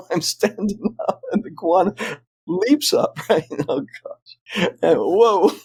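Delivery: words squeezed out through laughs; laughing. Real, not acted. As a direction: tell it through helpless giggles. Laughing as he retells the climax.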